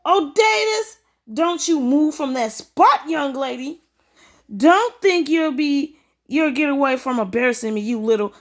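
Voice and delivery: high, loud and drawn-out scolding; a shrill-voiced stepmother laying down the law; stern stepmother scolding. Stepmother scolding her stepdaughter in a high, loud, slow voice.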